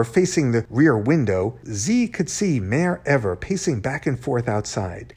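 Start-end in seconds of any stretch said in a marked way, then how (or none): none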